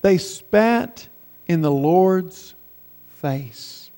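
slow and weighted, pauses between phrases, falling away soft; slow, deliberate reading. Shown as a demonstration. Preacher wanting listeners to think about it, slow deliberate reading. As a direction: let each phrase sink in, ending hushed and low. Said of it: "I want you to read that slowly".

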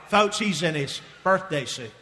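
normal talking voice

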